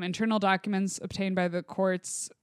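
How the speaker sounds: normal talking voice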